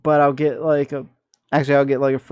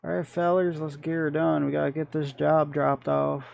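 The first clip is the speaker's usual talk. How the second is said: hammed-up Southern accent. Gamer to his truck crew, mock Southern accent. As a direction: play the good old Southern trucker boy. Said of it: "Got no idea why I'm talking like this".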